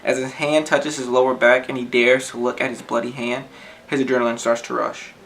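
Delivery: ordinary delivery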